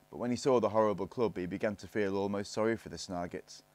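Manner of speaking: plain reading voice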